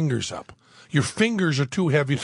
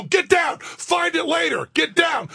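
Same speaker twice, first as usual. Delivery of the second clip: high frantic shouted order; panicked under fire; 11 semitones higher than usual. Retold or imitated. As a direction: scream it, danger is coming. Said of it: "They go".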